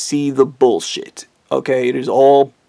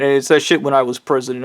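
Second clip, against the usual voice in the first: ordinary talk, nothing marked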